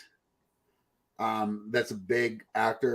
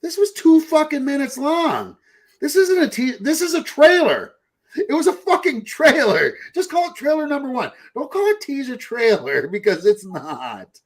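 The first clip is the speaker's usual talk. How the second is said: high-pitched, exasperated outburst; worked-up comic complaint; exasperated rant; 13 semitones higher, 5 dB louder than usual. Acted. Exasperated rant about teaser trailers.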